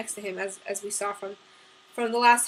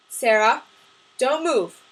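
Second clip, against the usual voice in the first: firm, controlled warning, no yelling; calm warning; 2 semitones higher, 8 dB louder, slower than usual. A narrator's reading. Sam warning Sarah about the gunman, calmly. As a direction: say DON'T MOVE firmly but keep it contained. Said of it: "Sam said calmly".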